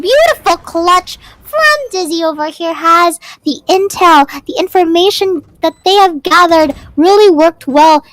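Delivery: loud, high hype call; excited. Real, not acted. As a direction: shout out the big clutch play. Caster hyping a clutch play.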